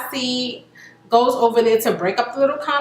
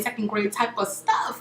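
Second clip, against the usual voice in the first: ordinary talk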